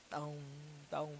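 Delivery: low soft tom tom; bong like a slow pendulum clock. Shown as a demonstration. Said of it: "eu estou imitando o barulho do relógio". (imitando relógio de pêndulo)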